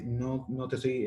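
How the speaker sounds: ordinary talk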